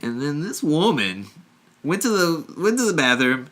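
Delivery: lightly voiced old man, rising high at the end; an old man reading aloud, getting squeaky. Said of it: "and he's like".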